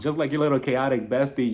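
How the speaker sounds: ordinary talk, nothing marked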